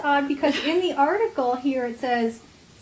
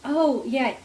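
usual speaking voice

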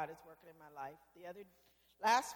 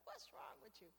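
a breathy whisper of an inner voice; whispered scolding; 2 semitones higher, 16 dB quieter than usual. Retold or imitated. Brenda hears her conscience in the pew, whispering. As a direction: hiss it to yourself like a guilty conscience.

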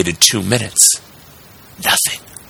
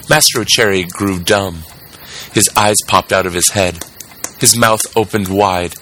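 plain reading voice

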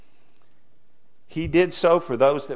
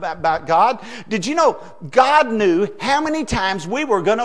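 questions pushed higher and louder; preaching at full force; 6 semitones higher, 5 dB louder than usual. Acted. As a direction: build toward the last line like a revival preacher.

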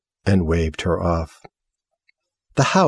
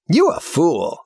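scornful retort; 9 semitones higher than usual. Acted. Girl scorns the house, high blunt scorn. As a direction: dismiss her bluntly.

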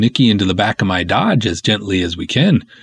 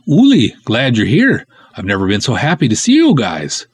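ordinary delivery

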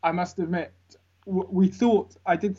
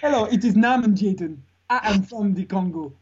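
put-on African accent; 4 semitones higher, 4 dB louder than usual. Introducing himself in character.

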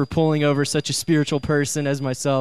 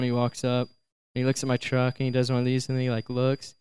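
usual speaking voice

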